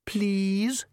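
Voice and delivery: high, wheedling plea; pleading. A narrator's reading. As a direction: beg with a rising lilt. Father begs his reluctant daughter.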